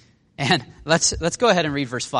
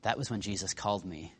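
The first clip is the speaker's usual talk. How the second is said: much quieter, lower, after a long pause; sobered remembering; 6 semitones lower, 13 dB quieter than usual. Acted. Peter remembering Jesus called him, subdued and sobered. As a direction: drop into a subdued realization. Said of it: "and then he remembers".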